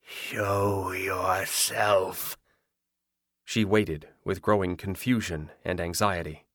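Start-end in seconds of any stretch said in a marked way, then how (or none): 0.0-2.4 slow, hollow, withering; a ghost's chilling command; cold ghostly whisper. A narrator's reading. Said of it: "her tone might have withered grass".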